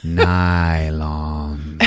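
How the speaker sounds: low, slow, drawn-out monster voice; giant monster. Acted. Comic showing how the monster talks, slow monster voice. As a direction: stretch the word out like a huge monster.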